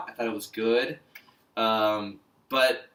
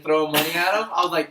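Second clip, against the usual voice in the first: ordinary talk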